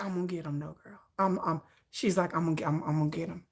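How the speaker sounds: low, soft and matter-of-fact; quietly certain she'll get him; low steady deadpan insisting; low, calm, determined friend. Retold or imitated.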